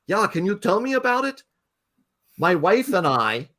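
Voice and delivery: high, lilting put-on Norwegian accent. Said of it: "I gotta do the accent".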